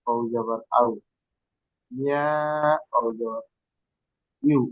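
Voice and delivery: ordinary delivery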